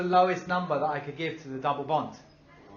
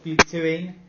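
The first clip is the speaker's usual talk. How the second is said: louder repeat; 2 semitones higher, 9 dB louder, slower than usual. Shown as a demonstration. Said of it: "It's in the middle".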